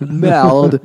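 Merde with a throaty guttural R; French pronunciation. Shown as a demonstration. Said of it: "Like you've got a piece of shit in your throat".

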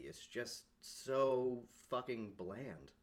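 stretched slow stress on "so fucking bland"; slow, disgusted emphasis; drawn-out disgust. Real, not acted. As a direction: lean on "so" and drag the whole line.

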